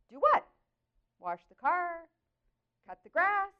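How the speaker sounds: high-pitched, puzzled guesses; baffled questioning. Retold or imitated. Wife guessing what her husband means to do, baffled questioning.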